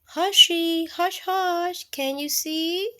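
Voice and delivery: hushing. Pretend. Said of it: "the way a baby hides".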